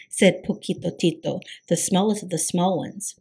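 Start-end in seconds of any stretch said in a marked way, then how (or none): none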